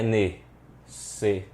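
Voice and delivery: "necessário" slowly as ne-ces-sá-rio, long gaps; split into four syllables. Shown as a demonstration. Teacher offers students a division, saying "necessário" slowly in four separated syllables. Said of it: "Como que é a divisão dessa palavra?".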